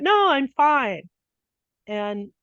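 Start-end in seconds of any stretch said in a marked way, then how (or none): 0.0-1.1 slow, high and loud mock insistence "No, I'm fine."; insisting he's fine. Retelling his answer to a worried friend, mock insistent. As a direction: overplay the brave denial.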